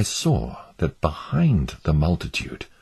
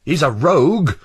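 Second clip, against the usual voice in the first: high, loud accusing juror; declaring; 10 semitones higher, 5 dB louder than usual. A narrator's reading. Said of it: "Mister Liar declared".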